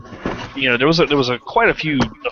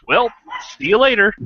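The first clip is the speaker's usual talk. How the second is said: Dumb and Dumber impression; 9 semitones higher than usual. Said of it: "that scene from Dumb and Dumber where he's like".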